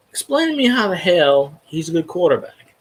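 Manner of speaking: normal talking voice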